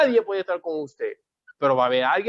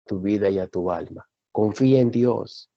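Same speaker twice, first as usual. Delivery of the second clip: deep, low, weighty preacher; solemn preaching; 7 semitones lower than usual. Real, not acted. Preacher telling the church nothing compares to God, solemn. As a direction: declare it gravely, pitched far down.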